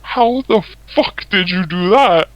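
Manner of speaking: retold awe, stretched out; imitated amazement. Retelling amazed friend's reaction.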